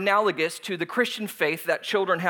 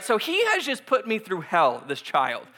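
normal talking voice

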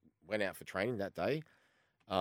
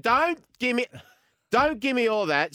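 imitated furious manager shouting, higher and louder; hammed-up angry boss yelling at a player; 10 dB louder, slower than usual. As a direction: bark it back like a fed-up gaffer.

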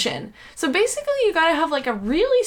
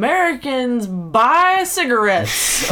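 louder, swooping mock title; hammed-up store name; 4 dB louder, slower than usual. Podcaster inventing silly liquor store names, loud and hammed-up. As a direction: proclaim it grandly, close to laughing.

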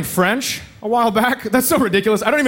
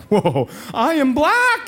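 higher, slow, stunned whoa; mock shocked realization; 5 semitones higher, much slower than usual. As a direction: drag it out in fake surprise. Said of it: "And I was like".

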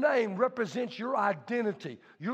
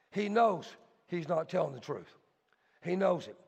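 ordinary talk, nothing marked

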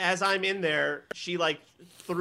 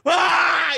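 high, loud howl of exasperation; mock scream as everything fails; hammed-up cry of defeat; acted frustrated scream; 10 semitones higher, 8 dB louder than usual. Acts out reaction to power cut.

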